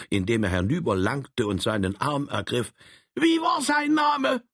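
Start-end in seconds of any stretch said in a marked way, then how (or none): 3.1-4.4 high shouting little Saxon; excited shout. Acted. Troll urgently asks Frank the name, high shout. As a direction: demand the name, high and loud.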